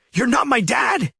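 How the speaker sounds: high, loud shrieking yell; teenage son shrieking at stepfather in front of guests; shrieked. Acted. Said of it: "he shrieked".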